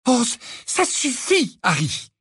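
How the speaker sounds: high-pitched, exasperated snap; angry retort. A narrator's reading. Hermione telling Harry that's enough, angry. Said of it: "répliqua Hermione avec colère".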